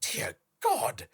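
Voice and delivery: a sudden high, loud "Dear God"; mock exclamation. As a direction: gasp it out like a scandalised reader. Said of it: "You might be thinking".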